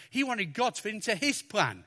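usual speaking voice

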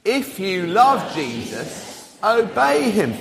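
very slow, measured group recitation; drawn-out words for a crowd to join; out loud together. Shown as a demonstration. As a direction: lead everyone in saying it slowly. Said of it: "say this out loud together".

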